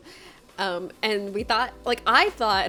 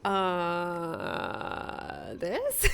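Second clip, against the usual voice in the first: awkward blank; 5 semitones lower, 3 dB quieter than usual. Retold or imitated. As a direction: act out having nothing to say. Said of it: "and I was like".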